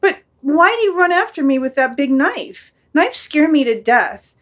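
scared and pleading; frightened dog. Acted. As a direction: question the master fearfully, rising high at the end. Dog questions the knife.

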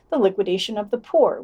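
usual speaking voice

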